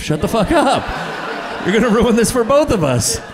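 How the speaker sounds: high-pitched, exasperated mock outburst; acted indignant outburst. Panicked husband silencing the counter woman.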